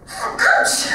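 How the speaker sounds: a sharp high yelp of pain; sudden ouch. Acted. Papa biting into Mama's pastry, yelping high. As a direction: yelp as the bite hurts.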